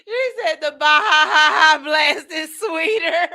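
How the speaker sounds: loud retelling through real laughter; helpless laughter. Real, not acted. Host quoting the Baja Blast prank, helpless with laughter. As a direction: belt it out while cracking up. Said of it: "I can't breathe".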